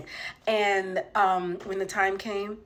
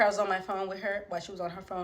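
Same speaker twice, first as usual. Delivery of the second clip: ordinary talk, nothing marked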